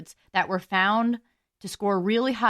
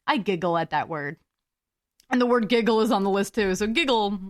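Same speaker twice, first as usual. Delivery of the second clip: ordinary delivery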